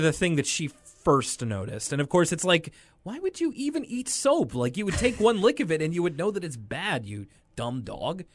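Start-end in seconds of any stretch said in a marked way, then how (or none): 3.0-8.2 incredulous. Retold or imitated. Imagined reaction to a dog eating soap, incredulous. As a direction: scold in mock disbelief. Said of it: "She's just kind of beside herself".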